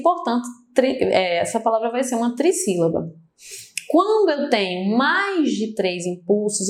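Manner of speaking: ordinary talk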